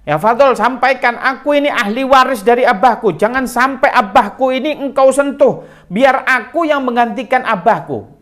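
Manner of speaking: high, loud and firm; ringing loud declaration. Retold or imitated. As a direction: declare it boldly, protecting her father. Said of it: "dengan lantang". (Fatimah berbicara dengan lantang)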